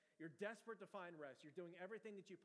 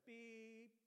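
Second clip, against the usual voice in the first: one long, higher-pitched beep; imitated alarm beep; 5 semitones higher than usual. Pastor imitating the alarm every fifteen seconds, long high beep. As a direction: hold a single electronic beep. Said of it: "It is just beeping".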